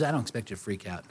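steady the frightened disciples quietly; calm reassurance. Pretend. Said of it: "he says".

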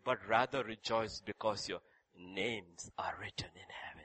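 hushed whispered revelation. Acted. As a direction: breathe it out as a secret. Quoting Jesus, sinks to whisper.

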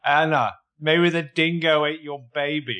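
slow, broad put-on Australian accent; mock Australian accent. Co-host mocking the fiancé's accent, slow hammed-up Australian. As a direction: ham up a bad Australian accent. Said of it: "Oh, it's Australian".

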